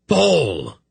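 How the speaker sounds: high, drawn-out call of alarm; alarmed shout. A narrator's reading. Dernick sees Pol vanish underwater, shouting. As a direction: cry out her name as she goes under. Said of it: "Dernick shouted again".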